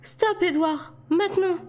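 high-pitched, urgent command; panicked order. A narrator's reading. Alice fears for Bella and orders Edward off, panicked. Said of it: "paniquée".

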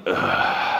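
imitated weary officer's groan; voiceless, frustrated sigh of disbelief; exasperated groan. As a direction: let out a mock exasperated ugh. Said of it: "the frustration on his face".

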